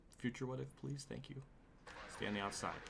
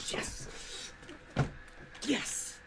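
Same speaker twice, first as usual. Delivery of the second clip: relieved cheer; 12 semitones higher, much slower than usual. Real, not acted. Finally passes the car.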